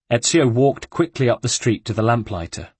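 ordinary talk

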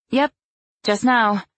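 high, loud chirpy reply. A narrator's reading. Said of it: "I hate the chirpiness in my voice".

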